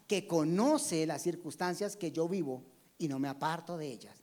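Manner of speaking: ordinary delivery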